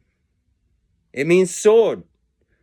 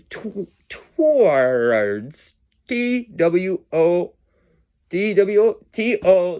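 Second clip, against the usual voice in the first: "towards" dragged out very slowly; sounding it out; slower than usual. Real, not acted. Working out a word's spelling, "towards" drawn out long.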